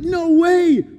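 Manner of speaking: high, loud disbelieving shout; imitating a stunned crowd; amazed. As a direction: shout it like an astonished spectator. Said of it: "People were amazed".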